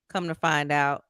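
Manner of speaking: ordinary delivery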